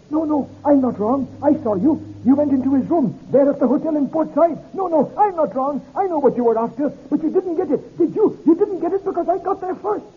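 high, shrill, hysterical man; shrill hysteria. Acted. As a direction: rise to a shrill hysterical pitch. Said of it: "His voice stopped on a shrill, high note of hysteria".

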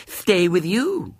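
put-on high, slow childlike matter-of-factness; simply, as little girl. A narrator's reading. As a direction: state it simply in a small child's voice. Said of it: "said Violet Elizabeth simply".

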